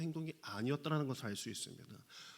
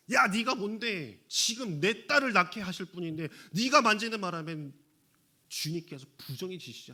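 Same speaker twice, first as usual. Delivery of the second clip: angry blame; 3 semitones higher, 7 dB louder than usual. Acted. Angry crowd blaming the woman.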